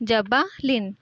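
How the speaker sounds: normal talking voice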